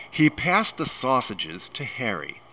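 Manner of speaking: ordinary delivery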